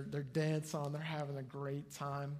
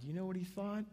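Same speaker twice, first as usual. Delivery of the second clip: ordinary talk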